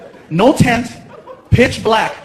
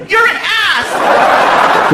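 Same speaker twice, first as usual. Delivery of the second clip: very high, drawn-out yell; mock furious screech at a partner; imitated angry girlfriend; 12 semitones higher, much slower than usual. Girlfriend phones after the prank, mock angry high yell.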